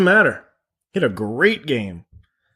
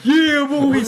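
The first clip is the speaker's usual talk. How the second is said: hype man shout; 12 semitones higher than usual. Acted. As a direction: hype up the boys. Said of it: "[name] over here".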